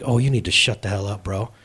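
low, unimpressed comeback; mock-weary put-down, pitched low; deadpan retort. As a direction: drop the pitch and shut him down. His deadpan retort to quarterback.

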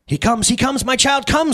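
high, lifted cry without a true shriek; excited screech. A narrator's reading. Sin summons her child Death, screeching high. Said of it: "screeches Sin".

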